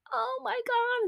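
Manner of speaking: very high mock squeal of delight; excited squeal. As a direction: imitate a thrilled family welcome, high-pitched. Imagined family's excited reply at door.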